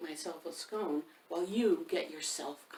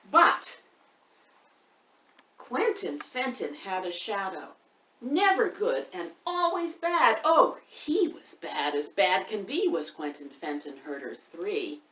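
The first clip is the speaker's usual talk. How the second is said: lively rhyme reading; 7 dB louder, slower than usual. A narrator's reading. Reading a rhyming book aloud to young children, loud and sing-song. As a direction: read the rhyme out big for the little ones, leaning on the rhythm.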